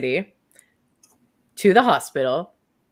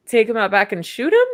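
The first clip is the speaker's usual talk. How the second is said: high, hammed-up confused questioning; mock alarmed disbelief; 10 semitones higher, 4 dB louder than usual. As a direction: pitch climbing into incredulous squeaks. Acts out Doe's alarmed disbelief.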